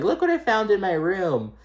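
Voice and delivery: high, teasing sing-song; imitating Rinna. Rinna showing off the Ouija board, high teasing. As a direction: tease it up high like a find. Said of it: "She's like".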